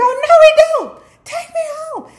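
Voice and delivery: very high, loud mock scream; wailing demand. Winter yells to be taken home.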